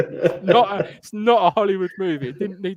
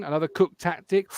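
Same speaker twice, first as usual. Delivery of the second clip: normal talking voice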